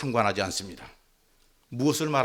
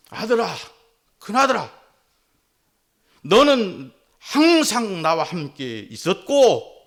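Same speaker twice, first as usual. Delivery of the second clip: pleading father; 6 semitones higher, 6 dB louder, slower than usual. Retold or imitated. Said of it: "아버지가 큰아들에게 말합니다".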